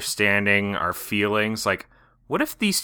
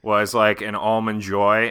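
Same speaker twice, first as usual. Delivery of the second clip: normal talking voice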